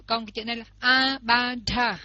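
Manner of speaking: usual speaking voice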